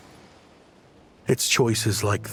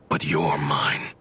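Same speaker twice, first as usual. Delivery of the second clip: low menace; 9 semitones lower than usual. Acted. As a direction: claim your prey, pitched well down. Threat to cornered enemy.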